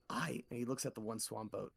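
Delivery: ordinary talk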